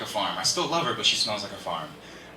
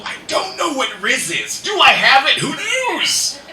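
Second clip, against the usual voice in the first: high, loud comic wail; hammed-up cry of bewilderment; mock exasperated outburst; 10 semitones higher, 6 dB louder than usual. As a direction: suddenly blurt it out high and loud. Comic outburst of confusion about Riz.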